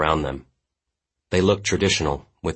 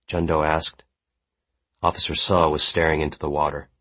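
plain reading voice

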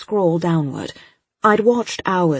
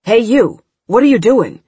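loud, higher angry yell; angry shout; 5 semitones higher, 9 dB louder than usual. A narrator's reading. Agent shouting at the girl at his screen, angry yell. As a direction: catch the intruder in the act.

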